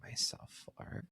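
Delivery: whispering the question to himself. Real, not acted. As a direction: breathe it out as a whisper.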